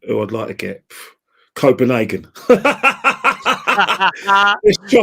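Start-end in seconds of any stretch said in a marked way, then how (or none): 2.3-4.6 high, loud burst of real laughter. Real, not acted. Laughing at Copenhagen draw hope. 4.6-5.0 squeezed out high and loud mid-laugh. Real, not acted. Laughing at his own pick.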